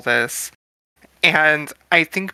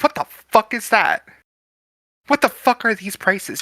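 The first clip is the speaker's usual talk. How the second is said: higher-pitched outrage at the menu; scandalised diner, voice raised in pitch; shocked disbelief; 6 semitones higher than usual. Acted. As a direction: balk in disbelief, pitch jumping up. Character reacts to menu prices.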